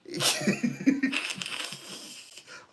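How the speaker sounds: high, wheezy laughing fit. Real, not acted. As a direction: crack up at your own sass. Laughs after sassy warning.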